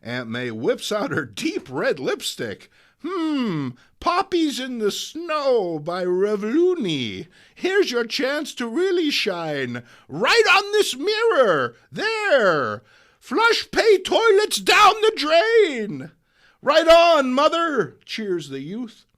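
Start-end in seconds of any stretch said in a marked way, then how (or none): 3.0-16.1 put-on high, loud proud old lady; high old woman defacing a mirror proudly; triumphant granny. May writes lipstick slogan. 16.6-18.0 high, loud enthusiastic cheer; high loud youth cheering the old lady. Acted.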